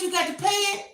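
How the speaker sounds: very high incredulous squeak; shocked disbelief. Retold or imitated. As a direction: act stunned that she must pay. Mocking shocked question.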